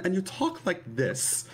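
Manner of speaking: high put-on Mid-Atlantic lady voice; Mid-Atlantic accent, woman's version. Shown as a demonstration. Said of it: "the Mid-Atlantic accent is something that changes if you're a woman".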